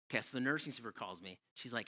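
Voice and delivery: normal talking voice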